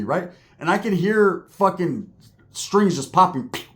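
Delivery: normal talking voice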